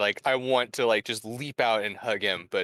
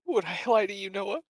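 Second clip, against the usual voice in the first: much higher, quick, laughing plea; laughing through tears; 10 semitones higher than usual. Acted. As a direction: laugh while begging him to trust you. Sister half-laughing, tearful.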